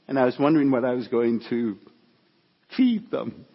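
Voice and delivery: choking up mid-quote; halting as real emotion takes over. Real, not acted. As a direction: try to get the words out while overcome. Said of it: "I get emotional sometimes when I speak".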